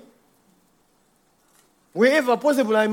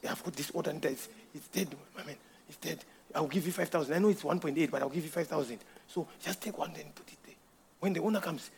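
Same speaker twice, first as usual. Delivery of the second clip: much softer, hushed dealer's pitch; sly hushed offer; 5 semitones lower, 16 dB quieter than usual. Retold or imitated. Another mechanic tempting him to swap the part, hushed and sly. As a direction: lean in and tempt him quietly. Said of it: "another man is coming and suggesting".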